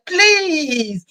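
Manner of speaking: high-pitched, insistent retold plea; re-enacting an exasperated, high-voiced intervention; irritated pleading. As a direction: scold a stranger for shouting at a child. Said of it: "I was just irritated".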